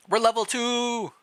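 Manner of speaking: high, loud excited cheer. Real, not acted. Player cheering to the group about leveling up, excited.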